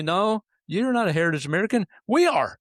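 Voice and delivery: mock-indignant correction, pitch climbing to the end; indignant retort. Host voicing Native Americans answering heritage claimants, mock indignant. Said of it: "they could look at all of us and say".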